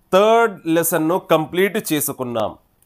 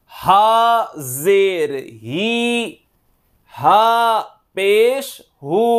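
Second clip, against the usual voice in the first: slow, drawn-out chant that rises on each vowel mark; qaida recitation drill; 6 semitones higher, 5 dB louder, much slower than usual. Shown as a demonstration. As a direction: sound out each syllable for students to copy. Teacher drills letters with vowel marks. (నెమ్మదిగా, రాగంతో అక్షరాలు పలకడం)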